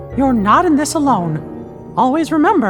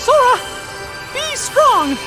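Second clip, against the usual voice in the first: high-pitched cry of encouragement; urgent call; slower than usual. Acted. Calling out to Sora in turmoil.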